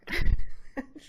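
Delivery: loud, breathy burst of laughter; a real wheezy laugh. Real, not acted. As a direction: laugh out hard at the punchline. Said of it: "laughs at end of story".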